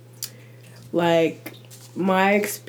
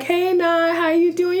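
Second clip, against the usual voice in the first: bright greeting; 9 semitones higher than usual. Retold or imitated. Friend greets brightly on phone.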